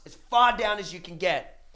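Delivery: ordinary delivery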